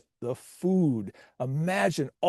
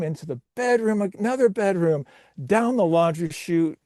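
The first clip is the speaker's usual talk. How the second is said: higher, excited play-by-play; race commentary; 4 semitones higher than usual. Acted. Commentator calling Grandma's wheelchair race, excited play-by-play. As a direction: call it like a live sports announcer.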